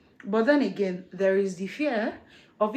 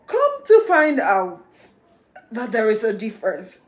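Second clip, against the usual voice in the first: dramatic surprise; 8 dB louder, slower than usual. Real, not acted.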